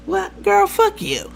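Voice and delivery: high, offhand imitation of a curt brush-off; casual dismissal. Host answering a simple comment, imitated as offhand. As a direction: toss it away in a high pitch. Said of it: "she said it like it was nothing".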